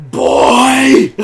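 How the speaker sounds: high, very loud drawn-out holler; furious mock shout. Slapped friend storming back at his co-star, imitated furious shout. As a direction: bellow it as a challenge.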